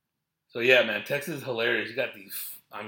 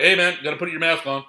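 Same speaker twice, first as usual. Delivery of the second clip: staff yelling; 7 semitones higher, 11 dB louder than usual. Retold or imitated. Staff ordering masks on.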